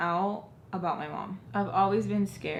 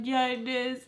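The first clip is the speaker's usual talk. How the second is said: imitated tearful insistence, higher; crying; 5 semitones higher than usual. Arguing with Joe's comfort, acted crying. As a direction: whine it back as if crying. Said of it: "Like, crying, right?".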